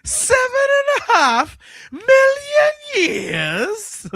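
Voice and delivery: high shouting laugh, slow. Real, not acted. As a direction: shriek it through laughter. Laughing outburst at the quote.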